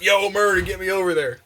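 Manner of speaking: mock urgent shout, lightly done; acted call-out. Character calls teammate for help.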